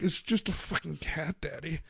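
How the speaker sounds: softly delivered imitation of toddler attitude. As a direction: mimic a matter-of-fact sassy toddler. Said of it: "attitude cocked hand".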